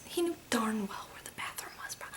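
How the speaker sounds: conspiratorial whisper. Real, not acted. Telling viewers in a hushed whisper. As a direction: lean in and whisper the gossip. Gossiping about a suspicious customer.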